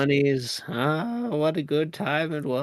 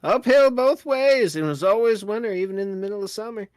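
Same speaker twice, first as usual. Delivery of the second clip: high, hammed-up old-timer rant; ranting grandpa, higher and higher; 10 semitones higher than usual. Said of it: "Not the grandpa boy".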